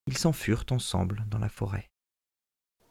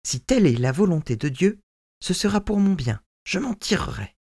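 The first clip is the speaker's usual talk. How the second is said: put-on higher, earnest young man reassuring his father; son's voice; 8 semitones higher, 5 dB louder than usual. Son reassuring his old father, higher and eager. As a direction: sound young and eager to comfort him.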